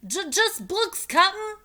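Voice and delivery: nervous high loud crewman shout; pirate shout. A narrator's reading. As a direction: stammer it out loud. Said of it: "shouted Stinky".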